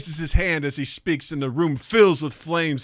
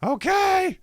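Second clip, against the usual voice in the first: put-on child voice. Boy replies to Yoko.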